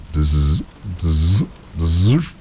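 buzzing bee imitation. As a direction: buzz like a bee at the window. Imitation for late arrivals.

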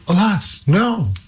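high, bright cry of alarm; princess crying out. Acted. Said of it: "cried she".

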